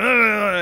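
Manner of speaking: high, loud mock spluttering; mock outraged babble. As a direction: imitate a guard spluttering in protest. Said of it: "he took the bait".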